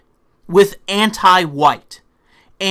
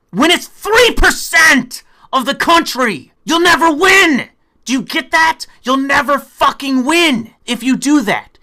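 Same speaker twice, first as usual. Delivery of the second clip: much higher, louder furious yelling; angry shout; 5 semitones higher, 4 dB louder than usual. Real, not acted. Berating progressives over their strategy, angry yelling. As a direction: shout it at them in disbelief. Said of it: "I'm getting so angry".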